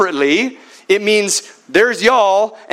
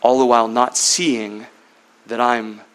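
Jesus; 8 semitones lower, 3 dB quieter than usual. Acted. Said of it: "And now he says".

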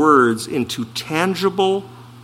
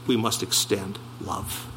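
much softer closing line, almost under breath; quiet hush; 7 semitones lower, 14 dB quieter than usual. Acted. Preacher closes his point to the church, hushed. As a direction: let it fall to a hush. Sermon point dropped soft.

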